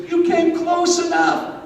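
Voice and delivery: very high, slow grandma voice; high-pitched, slow confiding grandmother. Acted. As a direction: draw it out in a high little old-lady voice. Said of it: "She says".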